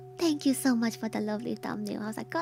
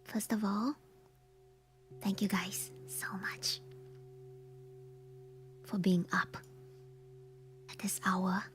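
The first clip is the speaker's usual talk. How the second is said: whispering; 8 semitones lower, 7 dB quieter than usual. Real, not acted. Whispered Halloween stream intro.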